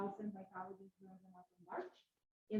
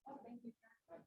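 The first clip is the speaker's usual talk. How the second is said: faint, off-mic voice; speaking too far from the microphone; 13 dB quieter than usual. Real, not acted. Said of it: "We are not able to hear you very well".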